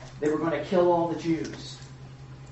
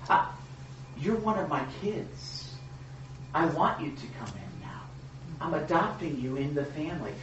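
tender Jesus voice; 6 dB quieter than usual. Acted. Jesus voiced speaking to believers.

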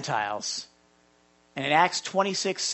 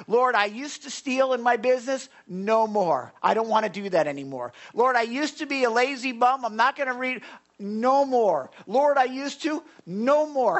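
rising pleas of "Lord" with punchy "No more" answers; fervent preaching; 4 semitones higher, 3 dB louder than usual. Acted. Pastor crying out to the Lord before the congregation, preaching at full force. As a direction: preach it hard, calling out to the Lord.

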